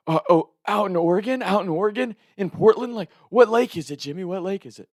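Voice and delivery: high, loud, rapid-fire questions; hammed-up high-pitched phone panic; frantic grandma. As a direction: imitate a flustered grandma piling up questions.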